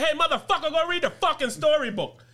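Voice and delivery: high, hard mock scolding; retold telling-off of the brother; scolding order. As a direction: order him to go, fed up. Scolding brother to read to sister.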